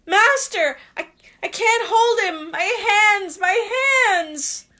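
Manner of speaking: high, loud panicked cry; panicked pain. Acted. Quirrell crying to his master as his hands burn, high and panicked. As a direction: stammer out your agony to your master.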